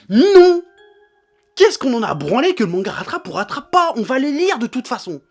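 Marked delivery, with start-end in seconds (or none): 0.1-0.6 loud high furious no; shouted no. Real, not acted. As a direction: yell the no flat out. Ranting, rejecting an excuse.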